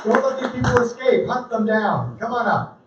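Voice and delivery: ordinary delivery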